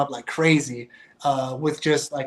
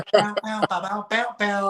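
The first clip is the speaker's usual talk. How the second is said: higher mock piano stabs, sung as sounds; hummed warning notes; 4 semitones higher than usual. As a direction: tap out the disapproving notes with your voice. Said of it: "He would just be like".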